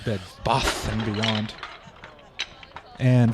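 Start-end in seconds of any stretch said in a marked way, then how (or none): 0.0-1.5 slow British-style broad "Bath"; accidental British accent. Shown as a demonstration. Repeating the slip that made wife laugh, British accent. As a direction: stretch "Bath" out in mock British. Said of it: "That's the best accent you ever had".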